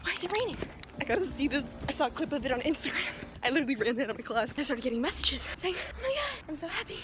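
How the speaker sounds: soft and breathless, fast in places; breathless hush. Real, not acted. Out of breath after running from class, soft and hurried. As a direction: gasp it out under your breath, rushing. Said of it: "I am out of breath".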